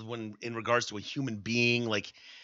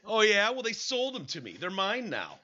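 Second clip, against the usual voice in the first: much higher, cocky put-on voice; mock defiant celebrity; 9 semitones higher than usual. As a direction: sneer it like a smug star brushing it off. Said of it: "imitating R. Kelly's defense".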